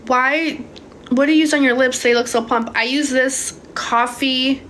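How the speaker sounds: usual speaking voice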